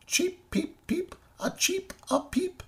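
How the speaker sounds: very high, light bird chirps; sparrow chirping. A narrator's reading. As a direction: chirp like a perky little sparrow.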